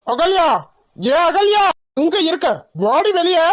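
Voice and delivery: high angry call across the house; a woman angrily calls her sister-in-law outside, high and sharp. Acted. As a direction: summon her out, furious.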